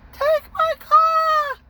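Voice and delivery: put-on very high, drawn-out drunken scream; drunk shout. Rhoda yelling to his friends, high drawn-out scream. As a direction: scream it from the cop car.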